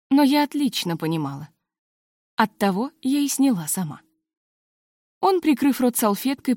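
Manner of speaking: usual speaking voice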